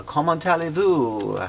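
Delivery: high, put-on French accent. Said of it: "doing this in a French accent".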